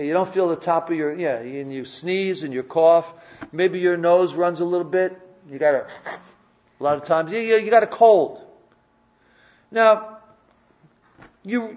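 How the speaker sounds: usual speaking voice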